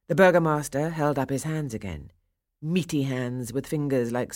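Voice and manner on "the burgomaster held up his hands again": plain reading voice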